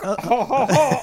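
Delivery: a hammed-up jolly laugh; mock belly laugh. Mock laugh at drunk stereotype.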